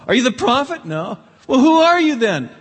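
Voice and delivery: high, loud, insistent questions; pressing crowd. Retold or imitated. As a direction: demand an answer as the crowd. Crowd pressing John, voiced high.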